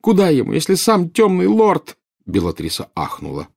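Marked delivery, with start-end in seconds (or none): 0.0-2.0 loud, high despairing protest; sobbing. A narrator's reading. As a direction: wail the question through sobs. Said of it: "рыдала Нарцисса". (рыдая, с отчаянием)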